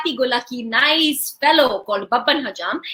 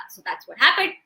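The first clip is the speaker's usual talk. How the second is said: plain reading voice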